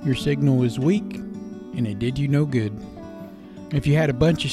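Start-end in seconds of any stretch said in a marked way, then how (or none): none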